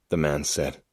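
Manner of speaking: ordinary delivery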